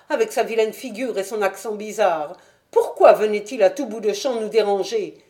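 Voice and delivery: a boatman's wife worrying about her husband; Mrs Smith. Acted. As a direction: fret aloud like an anxious wife. Landlady voiced by narrator.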